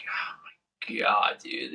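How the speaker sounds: slow hushed whisper; slow whispered disbelief. Retold or imitated. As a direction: whisper it so nobody hears. Whispered in crowded barracks.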